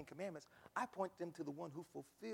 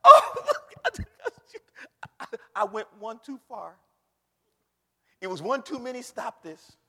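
laughing mama; 6 semitones higher, 20 dB louder than usual. Retold or imitated.

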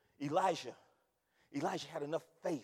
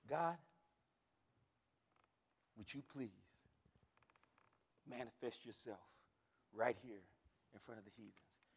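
low, soft, measured plea; hushed prayer; 7 semitones lower, 9 dB quieter than usual. Acted. Elijah asking God to manifest before the heathens, hushed. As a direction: pray it quietly, with long pauses.

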